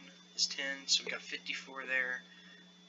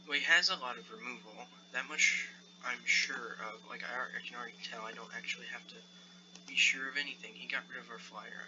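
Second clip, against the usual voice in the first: usual speaking voice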